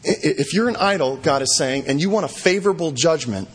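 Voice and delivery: ordinary delivery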